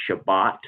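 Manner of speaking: Shabbat with a clear final t; Shabbat pronunciation. Shown as a demonstration. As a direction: say Shabbat, stressing bat with t. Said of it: "they pronounce it".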